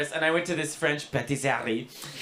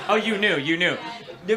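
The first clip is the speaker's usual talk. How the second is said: ordinary delivery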